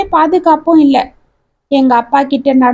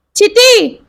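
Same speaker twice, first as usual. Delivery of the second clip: high-pitched cry of alarm; alarmed scream; 7 semitones higher, much slower than usual. A narrator's reading. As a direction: cry out to her in panic. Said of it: "என்று அலறிக்கொண்டு".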